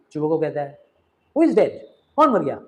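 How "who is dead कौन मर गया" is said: louder, higher startled demand; startled shout. Acted. Father shouts asking who has died. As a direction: burst out in alarm.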